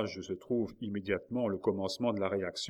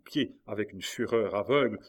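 ordinary delivery

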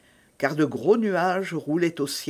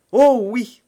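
loud, higher burst of assent; eager exclamation; 6 semitones higher, 9 dB louder than usual. A narrator's reading. Milady assures Felton she can follow, eager exclamation. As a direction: leap on the answer, raised and emphatic.

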